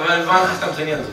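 normal talking voice

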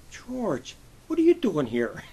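high, startled call of the name; surprised greeting. Retold or imitated. Captain surprised to see him.

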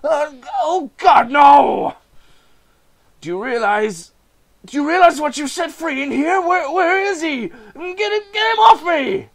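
high, loud, frantic terror; high screaming panic at a captor; panicked pleading. Acted. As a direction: beg for your life in a panic. Voices panicked figures in scene.

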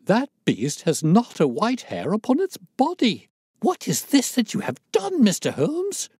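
very high, agitated protest; loud, scandalised demand; outraged owner. A narrator's reading. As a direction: climb into indignant, accusing alarm. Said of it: "cried the owner".

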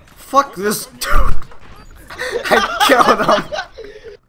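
high shouted laugh through the words. Real, not acted. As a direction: crow over the kill, laughing. Triumphant kill, shouting with laughter.

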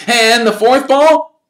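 put-on high, loud, eager boy's voice; excited young fan. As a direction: gush at your hero like a starstruck kid. Colin asking.

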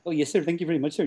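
quick, mock Indian English accent. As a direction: imitate a polite speaker with an Indian accent. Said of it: "with a very distinct Indian accent".

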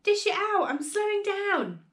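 high, insistent demand; urgent yell. A narrator's reading. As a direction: yell for it, drawing out the end. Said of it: "she yelled".

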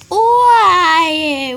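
loud, exasperated why; a sudden loud why at the game; exasperated yell. Real, not acted. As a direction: shout it out in frustration.

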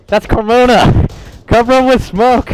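high loud call for cover; urgent shout. Real, not acted. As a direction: yell it out to the squad. Teammate exposed, urgent call.

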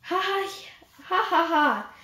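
mocking laugh. Animal laughing at the mouse's claim, mocking.

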